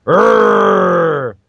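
long drawn-out "arr" roar; mock dinosaur roar. Reading Protoceratops' dialogue off the panel, drawn-out mock roar. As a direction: roar like a comic-book dinosaur. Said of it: "Protoceratops says".

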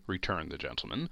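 usual speaking voice

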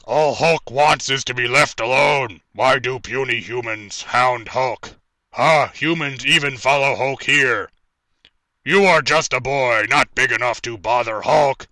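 slow, loud booming Hulk voice; big dumb brute, every word heavy; dramatic reading. Acted. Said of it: "I'm gonna attempt to do a dramatic reading here".

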